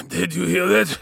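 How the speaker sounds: breathless, pained gasp. Acted. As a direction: gasp it out through the pain. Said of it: "gasped Tyro".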